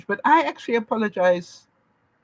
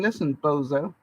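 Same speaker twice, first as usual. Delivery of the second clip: mock rude jab; 7 semitones lower than usual. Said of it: "nicer than saying".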